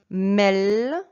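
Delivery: "Melon" split as mel, lon, loud and drawn out; L pronounced twice. Shown as a demonstration. Teacher shows learners the word with both Ls pronounced. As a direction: say "Melon" slowly, an L closing the first syllable and opening the second. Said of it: "il faut prononcer la lettre L deux fois".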